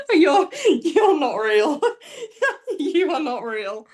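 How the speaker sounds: laughing through "you're not real"; can barely get it out for laughing. Real, not acted. Laughing at friend.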